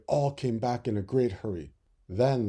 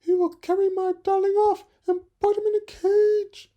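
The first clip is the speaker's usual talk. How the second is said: very high, loud fearful voice; frightened mother bird; 9 dB louder than usual. Acted. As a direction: fret over losing the chick. Mother bird fearing boy.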